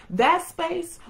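ordinary talk

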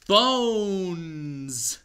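loud booming announcement; dramatic reveal. Acted. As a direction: unveil the monster. Monster reveal.

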